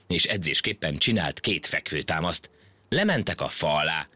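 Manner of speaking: ordinary delivery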